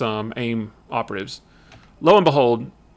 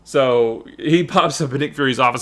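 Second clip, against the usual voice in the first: ordinary talk, nothing marked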